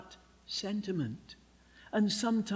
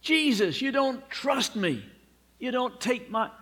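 higher, louder hurt protest; upset protest; 7 semitones higher, 4 dB louder than usual. Retold or imitated. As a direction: cry out at Jesus, wounded. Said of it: "now he's upset".

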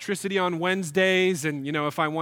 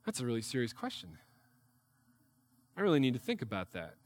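much lower and softer, weighing the question; mock-solemn friend pondering; grave Czech reply; 7 semitones lower, 9 dB quieter, faster than usual. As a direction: answer like it's a deep matter. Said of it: "He'd go".

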